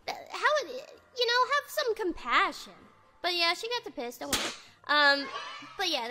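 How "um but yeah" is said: ordinary talk, nothing marked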